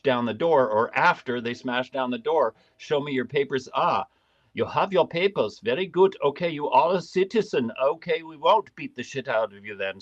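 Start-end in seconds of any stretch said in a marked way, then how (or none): 2.8-10.0 hammed-up German officer's accent; fake German accent. Gestapo-like agent checking someone's papers, fake German accent. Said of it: "Sorry for the German accent, fake German accent".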